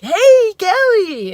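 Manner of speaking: loud, high, goofy mock greeting; overzealous Bill Cosby. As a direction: boom it out like an overeager date. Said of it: "overzealous Bill Cosby meets".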